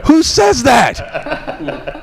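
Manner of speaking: very slow, high laughing disbelief. Real, not acted. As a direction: drag it out through laughter.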